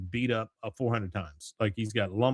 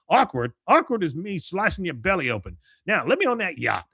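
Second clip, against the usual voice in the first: louder, higher mock outrage; acted indignant threat; 7 semitones higher, 4 dB louder than usual. Cotton threatens the ticket taker, in louder mock anger. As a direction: play an old colonel threatening a doorman.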